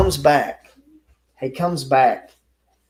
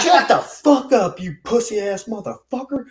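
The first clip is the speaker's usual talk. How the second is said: angry yell; 8 semitones higher, 5 dB louder than usual. Retold or imitated. The narrator yelling at the beaten man to shut up. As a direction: bark it down at him.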